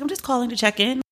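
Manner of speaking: imitating mother, high and casual; lightly voiced mother. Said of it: "my mother called me the other day".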